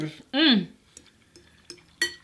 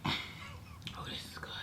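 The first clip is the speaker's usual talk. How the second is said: whispering; 21 dB quieter, much slower than usual. Real, not acted. Relief after drink.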